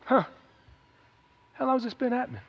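unruffled, hushed curiosity; calm, unfazed. Retold or imitated. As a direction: ask it quietly as if nothing is wrong. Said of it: "Jesus stood there and goes".

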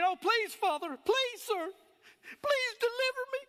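high, whiny pleading, hammed-up; mock a weepy, begging prayer; mock crying prayer. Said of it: "I'm just making fun of".

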